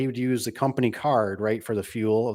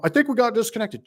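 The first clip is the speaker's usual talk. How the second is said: much higher mock caller voice; lightly voiced salesman playing innocent; sheepish caller; 12 semitones higher than usual. Cold caller phoning back after being hung up, high innocent voice.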